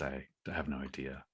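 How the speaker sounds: ordinary delivery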